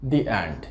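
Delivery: "the end" spoken "di end". Shown as a demonstration. Teacher repeats di end for students. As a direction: ee sound in "the" before end. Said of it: "আমরা উচ্চারণটা করছি দি".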